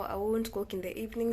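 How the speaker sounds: usual speaking voice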